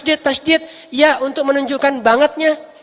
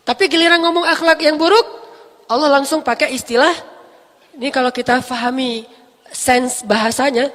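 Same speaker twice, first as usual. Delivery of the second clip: ordinary talk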